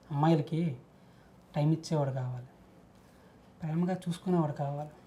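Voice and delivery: soft, dejected musing; subdued musing. Acted. Man musing on what girls want, subdued. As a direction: drop to a quiet, resigned murmur.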